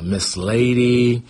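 ordinary talk, nothing marked

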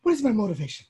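high, quick mock French accent; imitated French accent. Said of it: "French accent, it almost sounded funny".

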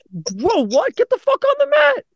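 hammed-up disbelief at a wimp; rough-and-tumble teammate telling the whiner to get on the mat; exasperated. Mocking the thumb complaint.

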